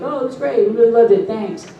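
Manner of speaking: chirpy. Retold or imitated. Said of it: "they sent me back".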